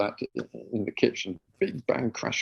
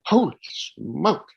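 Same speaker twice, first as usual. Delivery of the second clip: amazed; 5 semitones higher, 5 dB louder, much slower than usual. Retold or imitated. Relief after success.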